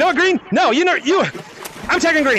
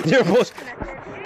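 normal talking voice

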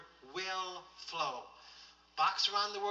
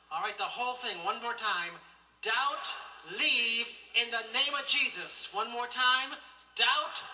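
loud, high, full-force rebuke; commanding preaching; 4 semitones higher, 7 dB louder than usual. Acted. Preacher leading the congregation in casting out doubt, commanding. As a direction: drive doubt out with a raised voice.

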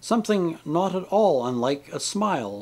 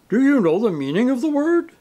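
high, loud declaimed challenge; grand, indignant outburst; theatrical tragedian; 10 semitones higher, 7 dB louder than usual. A narrator's reading. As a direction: hurl the question like a ham actor.

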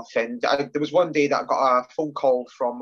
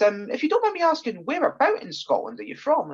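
lilting, high imitation of a light English twang; soft English twang; 10 semitones higher than usual. Caller asking call centre worker where he's from, soft English twang. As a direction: put on a polite, higher English twang. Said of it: "like, an English twang".

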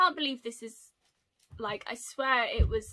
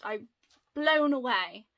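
ordinary talk, nothing marked

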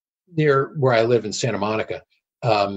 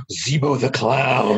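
gasp, then slow hushed wonder; slow, drawn-out retold amazement; awestruck recognition; much slower than usual. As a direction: imitate a fan stunned by a memory. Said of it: "And he just said".

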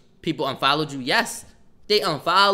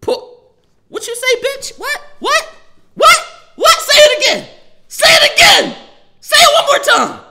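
shrieked, much higher and louder; furious challenge; 12 dB louder than usual. Real, not acted. Daring a chat heckler to repeat it, screaming. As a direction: dare her to say it again, screaming.

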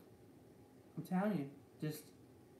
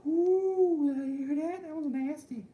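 high, loud, disgusted "ooh"; loud high delighted disgust; grossed-out exclamation; 11 semitones higher, 10 dB louder, slower than usual. Real, not acted. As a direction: squeal at the nasty noise. Reacting to his own gross noise.

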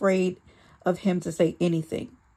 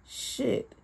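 ordinary delivery, nothing marked

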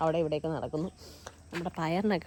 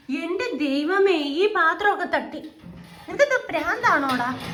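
exasperated; 9 semitones higher, 6 dB louder, slower than usual. Real, not acted. Pet knocks over the dishes.

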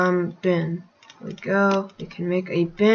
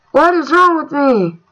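loud, higher self-scolding wail; real loud exasperation; exasperated outcry; 7 semitones higher, 9 dB louder than usual. Real, not acted. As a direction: cry out in frustration at your own blunder. Blames self after game mistake.

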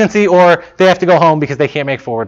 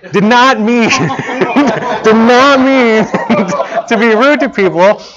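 laughing; 5 semitones higher, slower than usual. Real, not acted. Laughs through own denial.

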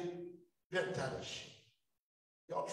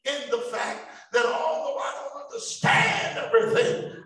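loud, rising preacher's call; full-force preaching; 3 semitones higher, 12 dB louder than usual. Acted. Pastor rousing the church to shout praise, full-force preaching. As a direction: whip the congregation up with praise.

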